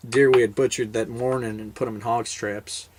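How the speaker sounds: ordinary talk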